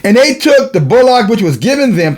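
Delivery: high, ringing scripture declamation; preaching. Real, not acted.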